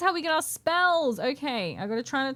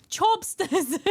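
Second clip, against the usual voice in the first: laughing; 7 semitones higher than usual. Real, not acted. Laughing repeat.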